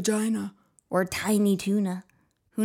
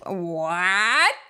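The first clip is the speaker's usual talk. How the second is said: ordinary talk, nothing marked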